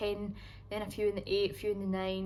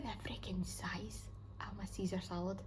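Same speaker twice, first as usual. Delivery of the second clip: whisper; 8 dB quieter than usual. Real, not acted. Showing huge salad to camera.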